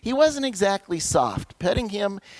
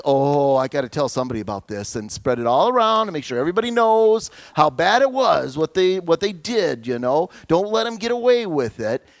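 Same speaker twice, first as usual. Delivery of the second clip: worked-up, louder tattling voice; indignant human nature; 4 dB louder than usual. Acted. Preacher voicing human nature's urge to get even, indignant. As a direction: rush it out like an outraged grudge-holder. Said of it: "Human nature says".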